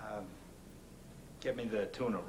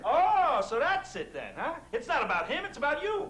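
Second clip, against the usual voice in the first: angry; 6 dB louder than usual. Acted. Heated argument over the kid.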